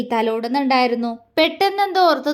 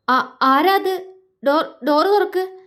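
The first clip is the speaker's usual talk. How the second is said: forced brave stammer; 5 semitones higher, slower than usual. A narrator's reading. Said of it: "ധൈര്യം സംഭരിച്ച അവൾ എങ്ങനെയോ പറഞ്ഞൊപ്പിച്ചു".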